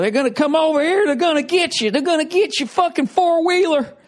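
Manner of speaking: high-pitched mock alarm about invaders; mock panicky warning. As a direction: shriek it like a hysterical fearmonger. Scaremongering quote, squeaky panic voice.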